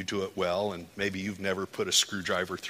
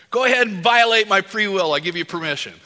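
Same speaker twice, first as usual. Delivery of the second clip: loud, high daring plea to God; booming mock-brave prayer; bold prayer; 9 semitones higher, 13 dB louder than usual. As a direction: throw it out as a fearless prayer.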